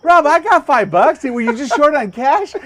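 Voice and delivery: high, loud goading offer; Kevin. Retold or imitated. Storyteller voicing his friend waving his wallet, goading. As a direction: holler it like a tactless friend.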